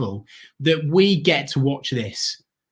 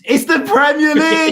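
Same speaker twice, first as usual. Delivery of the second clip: shout down the phone; 11 semitones higher, 6 dB louder than usual. Real, not acted.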